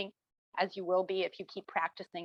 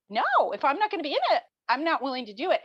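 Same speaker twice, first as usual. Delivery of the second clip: defiant refusal; 6 semitones higher, 6 dB louder, faster than usual. Retold or imitated. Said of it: "And he said".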